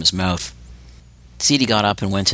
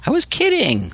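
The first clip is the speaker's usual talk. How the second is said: grinning. A narrator's reading. Seedy brushing it off to Spider, grinning. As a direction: say it with a grin. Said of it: "Seedy grinned".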